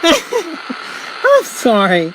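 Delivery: very high, very slow, laughing through the words; laughing apology. Real, not acted. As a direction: giggle out a drawn-out sorry. Laughing at the bad reception.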